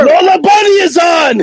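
shouted call to the next room. Retold or imitated. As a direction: holler it across the house. Calling out as Rusev.

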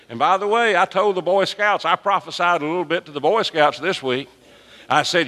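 high, driving preacher's voice; a pastor riled up about the Boy Scouts, preaching hard; fiery preaching. Acted. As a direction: preach it up with rising fervour. Preaching at full force, raised pitch.

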